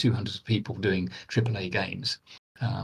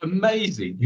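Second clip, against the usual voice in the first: usual speaking voice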